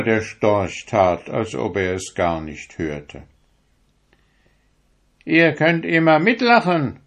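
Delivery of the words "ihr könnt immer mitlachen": high, loud sneering turkey; high, loud turkey mocking the stork. A narrator's reading. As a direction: sneer at the stork's thin legs. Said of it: "sagte der Kalekute zu ihm".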